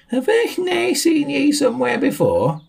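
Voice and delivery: squeaky high question. Acted. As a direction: ask in a tiny high squeak. Sam asks, very high.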